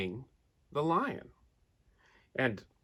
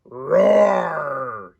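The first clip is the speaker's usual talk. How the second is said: lion roar; 3 semitones higher, 12 dB louder than usual. A narrator's reading. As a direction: let the lion roar out in fright. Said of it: "screamed the lion".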